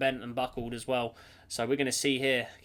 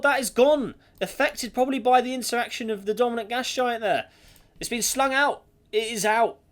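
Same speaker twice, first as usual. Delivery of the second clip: excited; 7 semitones higher, 7 dB louder than usual. Real, not acted. Planet suddenly ejected from system.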